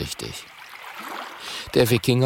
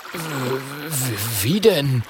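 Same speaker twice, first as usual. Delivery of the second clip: startled stammering Viking; flustered stammer; 4 semitones higher, much slower than usual. A narrator's reading. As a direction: stutter in bafflement. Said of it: "fragt Gudröd verdutzt".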